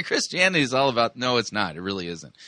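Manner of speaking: ordinary talk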